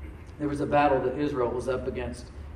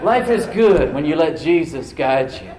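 louder and slow, laughing into it; bold practice declaration; 2 semitones higher, 10 dB louder, slower than usual. Shown as a demonstration. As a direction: proclaim it slowly and boldly. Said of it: "you gotta practice it".

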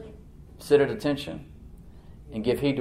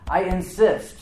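higher-pitched, firm declaration; insistent; 6 semitones higher, 3 dB louder, slower than usual. Retold or imitated. Preacher quotes Paul's very strong language, insistent. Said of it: "It's very strong language".

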